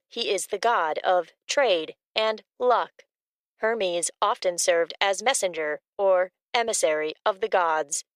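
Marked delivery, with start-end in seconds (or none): none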